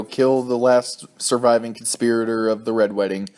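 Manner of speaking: ordinary delivery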